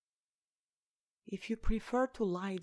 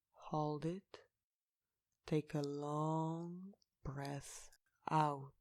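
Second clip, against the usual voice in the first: slow, soft meditation guidance with long pauses; guided meditation; 4 dB quieter than usual. Real, not acted. Guide leading listeners to relax each body part, slow meditation voice. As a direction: lead them gently into relaxation, unhurried and hushed.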